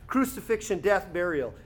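usual speaking voice

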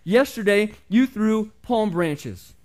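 loud, high, very slow reproach; indignant shout. A narrator's reading. The hurt donkey shouts at the crowd, loud and slow. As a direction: drag out the complaint, loud. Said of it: "he shouted".

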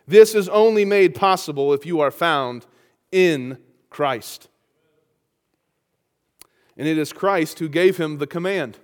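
ordinary delivery

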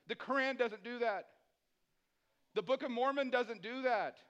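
loud, high preaching at full force; rising passionate sermon voice; fiery preaching. Acted. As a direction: preach it hard to the church.

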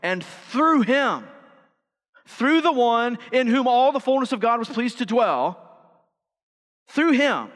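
loud, high preacher's proclamation; emphatic preaching. Acted. Preaches at full force.

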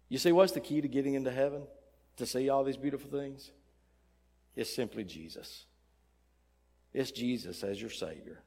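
ordinary delivery

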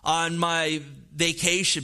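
ordinary talk

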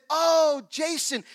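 high, loud and bright with recognition, Oh flung up high; delighted recognition. Retold or imitated. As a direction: greet a long-awaited guest with sudden joy. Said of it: "I hear this British accent".

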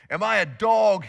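a loud giant's roar; loud roaring taunt. A narrator's reading. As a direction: bellow it at the boy, mocking. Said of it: "he roared at David".

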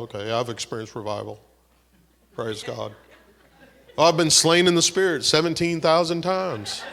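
lampoon the been-there-done-that churchgoer; lightly voiced smug churchgoer, chuckling; mock smug believer. Mocking a complacent believer.

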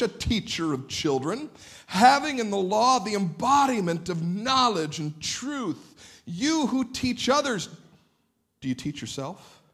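rising, emphatic Bible reading; forceful scripture reading. Acted. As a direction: read it like a charge to the hearers.

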